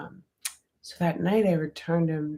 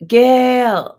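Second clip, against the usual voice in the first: calling out; 10 semitones higher, 13 dB louder than usual. Real, not acted. Calling out to someone.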